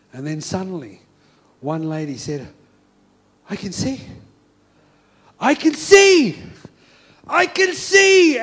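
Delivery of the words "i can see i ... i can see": rising to a high, loud shout, slow; healed woman shouting joy; ecstatic cry. Acted. Healed woman to the crowd, ecstatic shouting.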